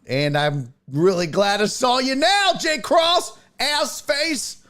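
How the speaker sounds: playfully fake-furious at a viewer; mock outrage. Real, not acted. Streamer to Jay Cross after his joke, mock outraged.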